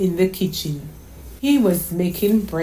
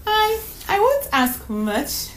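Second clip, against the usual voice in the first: put-on much higher, slow, halting child; little girl's voice; 13 semitones higher, slower than usual. Daughter missing her mother on the phone, wistful. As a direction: stammer like a sad small girl.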